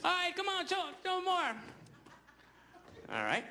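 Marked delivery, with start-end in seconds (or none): 0.0-1.5 high-pitched coaxing call; acted trainer's command; elephant trainer urging. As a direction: push the animal on in a raised, high voice.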